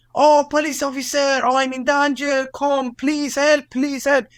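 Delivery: sarcastic high-pitched "save me" act; mock plea for help. Mimics imagined plea for help.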